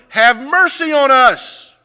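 high, loud, stretched-out plea; yelling. Retold or imitated. Blind man yelling to the rabbi, crying out. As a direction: yell slowly over the crowd. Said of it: "this guy's yelling".

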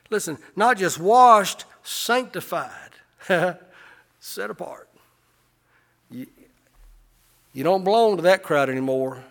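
loud, high, fervent pulpit preaching; a revival preacher at full cry; preaching at full force. Acted. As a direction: preach it out and lift them up. Pastor preaching to congregation.

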